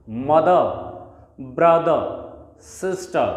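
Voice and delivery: mother, brother, sister with no final R sound; non-rhotic endings, no R on mother, brother, sister; silent final R. Shown as a demonstration. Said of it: "last आर को हमें pronounce नहीं करना है".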